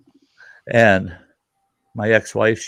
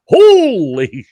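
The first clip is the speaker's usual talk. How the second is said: much higher, louder, drawn out slow; shocked exclamation; 14 semitones higher, 7 dB louder, much slower than usual. Retold or imitated. Family watching father and son fight, slow shocked cry. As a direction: stretch it out in disbelief. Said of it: "Whole family's like".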